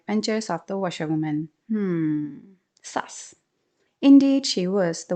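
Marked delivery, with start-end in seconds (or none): 1.7-3.3 whispered suspicious aside; hint at a secret. Acted. Narrator hinting the wife is up to something, suspicious whisper.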